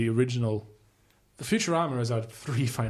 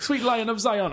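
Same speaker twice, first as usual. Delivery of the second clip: put-on much higher, hammy Jamaican accent; 11 semitones higher, 3 dB louder than usual. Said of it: "This is a terrible Jamaican accent".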